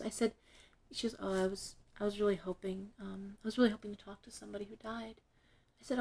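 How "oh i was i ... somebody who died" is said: soft, halting retold plea; timid hope. Young client hopes to reach the dead, timid. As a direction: voice a hesitant client, much softer.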